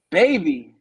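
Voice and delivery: put-on loud, indignant echo of "baby"; punched "baby" with a falling tail; taken aback. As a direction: throw "baby" back in disbelief. Storyteller's startled echo of the word.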